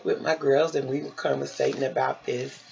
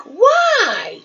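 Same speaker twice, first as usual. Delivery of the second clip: shocked why; 14 semitones higher, 4 dB louder than usual. Retold or imitated. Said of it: "I was like".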